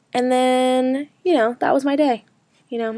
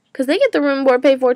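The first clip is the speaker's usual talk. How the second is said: ordinary talk, nothing marked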